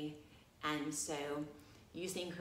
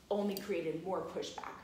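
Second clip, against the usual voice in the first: ordinary delivery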